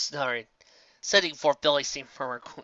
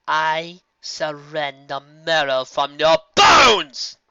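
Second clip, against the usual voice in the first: slow, much louder roaring threat; furious scream; 3 semitones higher, 10 dB louder, much slower than usual. A narrator's reading. Nightmare Moon threatens the filly, slow furious scream. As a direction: tear into her with every word. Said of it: "she screamed".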